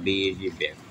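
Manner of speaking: ordinary talk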